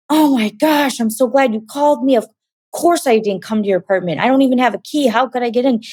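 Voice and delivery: high, panicked, rushing words; frantic brother. Retold or imitated. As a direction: brother who hasn't slept, terrified. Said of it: "He sounded frantic and crazy".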